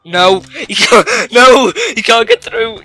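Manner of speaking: ordinary delivery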